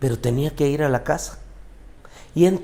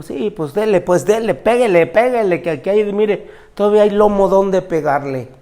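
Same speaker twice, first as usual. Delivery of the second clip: defiant dare; 7 semitones higher, 8 dB louder than usual. Retold or imitated. Son braced, daring father to hit.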